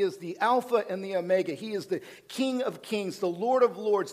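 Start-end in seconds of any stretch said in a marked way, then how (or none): none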